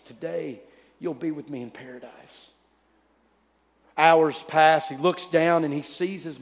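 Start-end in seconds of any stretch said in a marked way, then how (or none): 0.0-2.5 breathless whisper. Acted. Dying Jesus gasping out promise.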